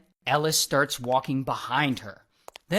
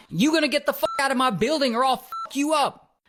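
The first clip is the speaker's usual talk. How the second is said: heated comeback in a put-on voice; retold yelling; 7 semitones higher than usual. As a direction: imitate a guard squaring up for a fight. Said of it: "these two start yelling at each other".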